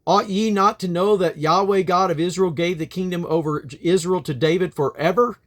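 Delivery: proclaiming from a mountain. Acted. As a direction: call it out like a king addressing the enemy host. Abijah calls out from mountain.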